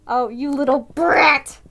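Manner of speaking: loud, slow mock-offended cry; loud teasing indignation; mock outrage. Real, not acted. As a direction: scold the character playfully. Reacting to fictional Tommy.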